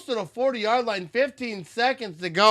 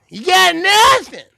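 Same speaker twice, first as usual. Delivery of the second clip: loud exclaim; 11 semitones higher, 6 dB louder, slower than usual. Acted.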